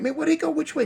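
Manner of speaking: very high, urgent questioning; riled-up homeboys. Retold or imitated. Homeboys looking for the gunman, urgently high. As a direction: imitate buddies ready to give chase. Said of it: "So they looking like".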